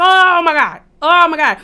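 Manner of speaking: loud, high cry of shock; shocked exclamation. Retold or imitated. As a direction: reenact a horrified reaction at full voice. Retelling own shocked reaction.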